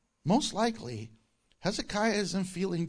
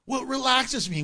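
mock excuse; 7 semitones higher, 5 dB louder than usual. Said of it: "I hear all the excuses".

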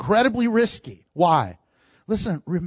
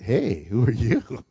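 smooth interest; 9 semitones lower, slower than usual. Retold or imitated. Preacher jokes Boaz greets the woman suavely, smooth interest. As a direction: draw it out low, as if flirting. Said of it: "We don't know the tone of his voice".

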